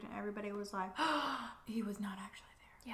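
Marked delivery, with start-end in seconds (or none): 1.0-1.6 whispered gasp. Retold or imitated. Retold shocked crowd reaction. 1.7-2.9 shocked whisper. Retold or imitated. Retold shocked crowd reaction.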